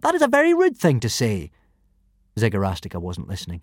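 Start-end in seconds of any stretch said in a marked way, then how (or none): 0.0-1.5 indignant protest. Acted. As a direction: protest like an offended boy. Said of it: "protested Hiccup".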